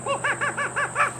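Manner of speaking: a shrieking happy monkey; monkey squeal. Acted. George squeals back at his friend, high monkey laugh.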